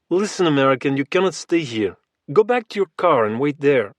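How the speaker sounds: put-on heavy, almost comic Russian accent, cold and flat; thick Russian accent. A narrator's reading. Russian giant ordering Logan off the property, thick Russian accent. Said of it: "The Russian accent was extremely thick now".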